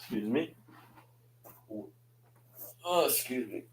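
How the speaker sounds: hiccuping between words; interrupted by hiccups. Real, not acted. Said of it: "I have the hiccups".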